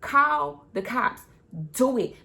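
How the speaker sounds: daring attitude. Retold or imitated. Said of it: "I said".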